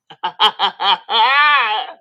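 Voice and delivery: loud cackling laugh; big laugh. Real, not acted. Teller bursts out laughing at her own story. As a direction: crack up loudly.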